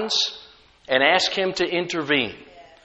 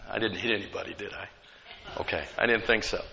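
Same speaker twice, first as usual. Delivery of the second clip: low, much softer offhand aside; quiet aside; 8 semitones lower, 11 dB quieter, much faster than usual. Real, not acted. Preacher checking with congregation that nobody got hit, quiet aside. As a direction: drop right down as if checking on the side.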